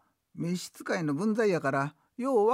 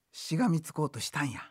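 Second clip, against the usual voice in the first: ordinary talk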